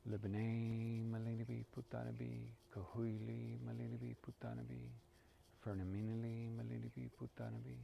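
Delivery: steady, measured chanted prayer; intoning a sacred prayer to the ancestors; prayer recitation. Acted. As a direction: recite each line like a sacred litany.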